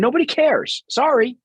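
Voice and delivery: cold, blunt, pitched high; blunt dismissal. Real, not acted. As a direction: brush it off bluntly, no softening. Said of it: "I mean, it sounds cold. It sounds blunt".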